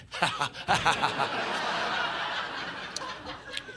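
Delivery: soft breathy cackle trailing down; long smug laugh. Retold or imitated. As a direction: gloat with a wheezy laugh. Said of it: "I'm like".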